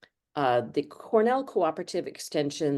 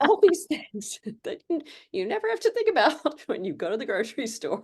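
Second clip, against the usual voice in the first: laughing; 10 semitones higher than usual. Real, not acted. Laughing while explaining farming.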